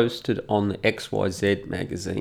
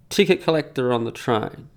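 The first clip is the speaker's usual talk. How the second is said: ordinary talk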